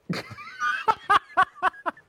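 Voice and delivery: loud high burst of laughter. Real, not acted. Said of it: "I'm trying not to laugh".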